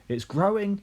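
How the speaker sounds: put-on high, loud boyish cry; excited yell. A narrator's reading. George yelling at the growing hen, high and loud. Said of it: "he yelled".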